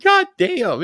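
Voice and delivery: high, stunned imitation of Jimmy; mock shocked. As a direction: squeal it out in disbelief. Jimmy's shocked reaction retold.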